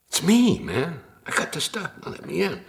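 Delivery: high, dopey stoner voice; whiny stoner begging at the door; dumb stoner voice. Retold or imitated. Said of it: "My dumb stoner voice".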